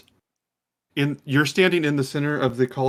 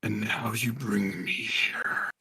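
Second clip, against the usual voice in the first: slow, sinister breathy whisper; whispering beast; 3 semitones lower, 8 dB quieter, much slower than usual. Acted. Cerberus addresses Callista in the arena, slowly whispering. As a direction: hiss it low and drawn out. Game master voices Cerberus head.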